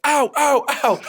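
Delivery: high, pained yelps of ow; imitating a friend's high yelps; mock yelling. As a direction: yelp it like a friend hurt in the back seat. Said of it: "He starts yelling".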